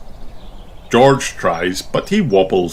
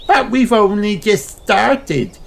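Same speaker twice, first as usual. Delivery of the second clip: Peppa pleading. Acted. Peppa pleads with Daddy, high and coaxing. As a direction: beg Daddy sweetly.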